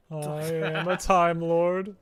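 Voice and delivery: mock-grand Time Lord echo. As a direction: ham up the grand declaration. Friend echoes grand line.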